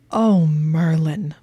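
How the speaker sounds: slow, strangled groan. Acted. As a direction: squeeze it out, strangled. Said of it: "in a strangled voice".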